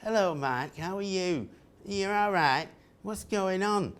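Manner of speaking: a lightly mocking Sheffield accent, high and chirpy. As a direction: imitate the annoying Sheffield colleague's greeting. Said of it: "she had a terribly annoying accent".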